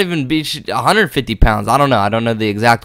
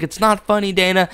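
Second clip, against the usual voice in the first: mock woman's voice; 6 semitones higher than usual. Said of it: "She's like".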